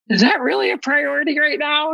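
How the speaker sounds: high, disbelieving question; incredulous. Retold or imitated. Caller to the hotline lady, high and incredulous. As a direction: ask like she can't believe it. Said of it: "I'm like".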